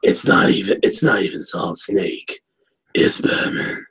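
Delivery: low, raspy superhero growl; Batman. Shown as a demonstration. Cohost joking it's Batman, not Solid Snake, raspy. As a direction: rasp it out with hardly any voice. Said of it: "this is a raspy voice".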